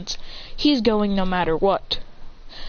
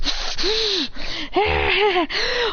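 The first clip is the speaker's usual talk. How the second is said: high dramatic gasp; 10 semitones higher than usual. Acted.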